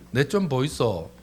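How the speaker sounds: light Gyeongsang lilt; Gyeongsang dialect. Shown as a demonstration. Excusing oneself as Gyeongsang people do, Gyeongsang accent. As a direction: say it like a Gyeongsang local. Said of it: "경상도는요".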